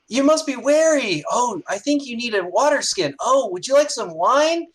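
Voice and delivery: high, loud, swooping sing-song fussing; fawning gnome girls. Acted. Gnome girls welcoming heroes home, fawning. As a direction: coo and fuss over the weary guests.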